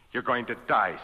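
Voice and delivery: ordinary talk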